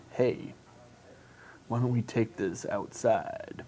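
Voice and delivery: low, quiet creepy come-on; creepy guy voice. Pretend. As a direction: lean in like a creepy stranger. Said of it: "In that creepy guy voice".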